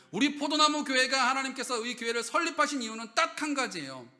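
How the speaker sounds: loud, high preaching to the congregation; full-force preaching. Acted. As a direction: drive the point home from the pulpit.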